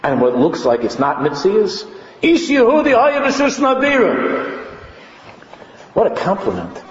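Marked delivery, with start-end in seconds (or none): 2.2-4.7 high, sustained ringing proclamation of a verse; high-pitched preacher's cry; preaching declamation. Real, not acted. Declaims a verse at full force.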